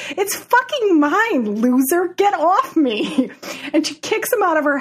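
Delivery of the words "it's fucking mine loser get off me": high, drawn-out mock outrage; slow, high-pitched imitation of telling him off; hammed-up indignant comeback, each word stretched; acted angry retort. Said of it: "kicks him really hard and goes".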